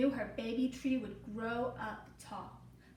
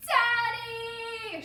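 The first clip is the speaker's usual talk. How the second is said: loud, higher call; shouted; 9 semitones higher, 8 dB louder than usual. Acted. Emmy shouting to Daddy over the mower. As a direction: call out across the yard. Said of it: "she shouted".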